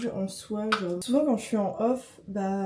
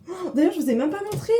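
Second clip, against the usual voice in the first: excited; 9 semitones higher, 6 dB louder, faster than usual. Real, not acted. Suddenly remembers something to show.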